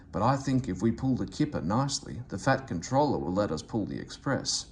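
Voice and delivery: usual speaking voice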